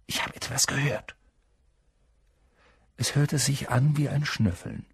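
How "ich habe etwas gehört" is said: softer whispered warning. A narrator's reading. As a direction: breathe it urgently. Harry whispers in the dark.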